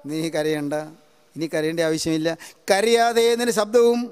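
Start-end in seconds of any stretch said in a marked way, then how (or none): 0.1-2.3 gentle comfort. Acted. Preacher soothing a weeping listener.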